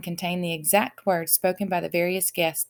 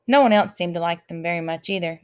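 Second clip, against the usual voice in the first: ordinary delivery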